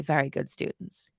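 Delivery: usual speaking voice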